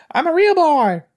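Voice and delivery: very high, loud squeaky little voice; loud, high-pitched cartoon boy; puppet boy voice. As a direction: pipe it up, far above your own voice. Quotes a puppet-like character's line.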